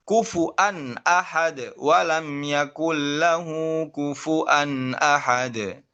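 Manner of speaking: slow recitation, kufu'an with a clear glottal stop; with hamzah. Shown as a demonstration. Teacher recites Syu'bah's reading of Al-Ikhlas. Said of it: "Imam Syu'bah membaca bagaimana?".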